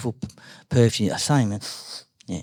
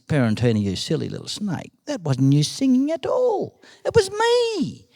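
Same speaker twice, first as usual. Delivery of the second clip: scolding, climbing to a drawn-out squeal; taunting little bird; 3 semitones higher than usual. Acted. Bird mocks the silly snake, taunting. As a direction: gloat as the bird, rising high at the end.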